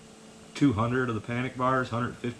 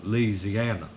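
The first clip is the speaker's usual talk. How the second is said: Southern buddy impression; much slower than usual. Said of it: "He said".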